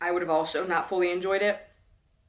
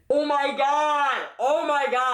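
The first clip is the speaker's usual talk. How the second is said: loud, high, drawn-out exclamation; exasperated outburst; 6 semitones higher, 4 dB louder than usual. Real, not acted. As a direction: cry it out in amused disbelief. Exasperated outburst over narrator's accent.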